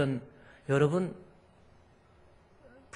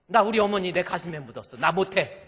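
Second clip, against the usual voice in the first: raised, higher voice without screaming; firm aggrieved refusal; 3 semitones higher, 6 dB louder than usual. Retold or imitated. Daughter-in-law who buried her mother, firm aggrieved refusal. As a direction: insist on refusing, wronged and loud. Same grievance, below the scream.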